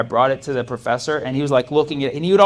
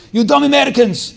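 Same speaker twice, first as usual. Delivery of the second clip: loud, high mock Ukrainian professor ranting; Ukrainian accent; 10 semitones higher, 7 dB louder than usual. Intense Ukrainian professor berating the class, mock loud Ukrainian accent. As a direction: yell at dumb Americans like the intense Ukrainian professor. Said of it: "He's like".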